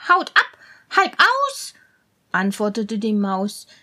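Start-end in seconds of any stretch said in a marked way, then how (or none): 0.1-1.7 high, louder mouse voice; puzzled mouse. Acted. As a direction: mull the names like a mouse. Mouse repeats names.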